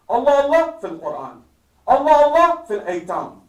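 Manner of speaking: "Allah, Allah" punched loud at each start; emphatic appeal. Retold or imitated. Imam urging those around him, emphatic "Allah, Allah". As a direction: hammer "Allah, Allah", then let the phrase fall. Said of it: "he's emphasizing".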